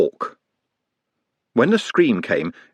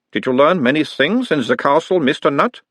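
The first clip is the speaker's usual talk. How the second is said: put-on mild Uberwald accent; 6 semitones higher than usual. A narrator's reading. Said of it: "mild Uberwaldian accent".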